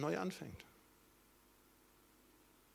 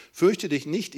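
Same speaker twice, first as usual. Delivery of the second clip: loud call; 4 semitones higher, 11 dB louder, slower than usual. Acted. God's loud reassurance.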